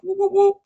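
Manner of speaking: high, loud repeated whoop sound effect; suction noise. Retold or imitated. Nurses cleaning out the baby, high repeated whoops. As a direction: mimic the suction machine in quick whoops.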